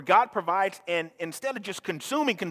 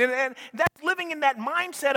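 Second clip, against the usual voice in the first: loud, high, driving sermon delivery; pushing the point home to the congregation; full-force preaching; 12 semitones higher, 4 dB louder than usual. Acted. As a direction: preach it with rising fire.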